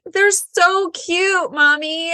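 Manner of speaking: put-on high, slow, teary little-girl voice; sobbing little daughter. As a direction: mimic a weepy child gushing through tears. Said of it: "And she's like".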